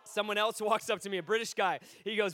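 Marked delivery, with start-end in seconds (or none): none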